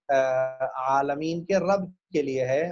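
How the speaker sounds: usual speaking voice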